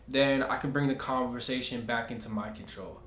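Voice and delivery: ordinary talk, nothing marked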